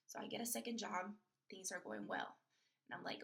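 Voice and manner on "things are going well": ordinary delivery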